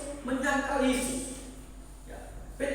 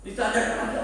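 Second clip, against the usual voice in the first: normal talking voice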